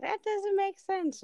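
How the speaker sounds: high, slow mock kid whine; imitating daughter. Mom replaying daughter's refusal over zero, high drawn-out mimicry. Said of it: "she's like".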